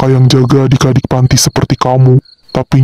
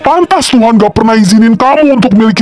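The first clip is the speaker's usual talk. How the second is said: angry plea; 11 semitones higher than usual. Acted. Confronting Kenzi, defending Davina.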